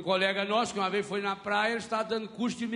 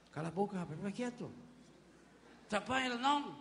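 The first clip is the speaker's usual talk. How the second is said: hushed, much softer scolding; hushing rebuke; 9 dB quieter, much faster than usual. Retold or imitated. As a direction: shush him down sharply. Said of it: "o que as pessoas começaram a fazer".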